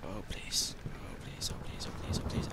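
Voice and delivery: breathy scared begging under the breath; frightened pleading whisper. Real, not acted. Scared player begging the game, whispered pleading.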